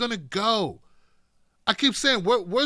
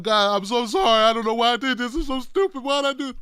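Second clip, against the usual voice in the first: whiny, louder, tearful drunk apology in a hammed-up voice; mock drunk crying; 2 semitones higher, 4 dB louder than usual. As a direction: play the sobbing drunk saying sorry, half joking. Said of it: "in a puddle of his tears crying".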